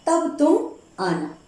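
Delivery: usual speaking voice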